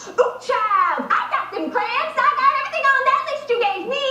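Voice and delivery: high-pitched, hammed-up audition read; exaggerated urban caricature for a casting.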